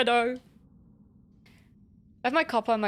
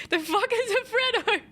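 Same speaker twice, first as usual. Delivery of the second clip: laughing disbelief; 12 semitones higher than usual. Real, not acted. Friend baffled by British slang.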